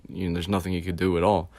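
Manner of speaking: plain reading voice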